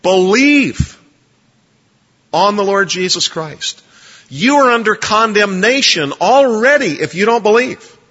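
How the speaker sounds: loud, high proclamation of the gospel; authoritative announcement. Shown as a demonstration. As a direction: declare it with no apology. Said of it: "this is an authoritative announcement".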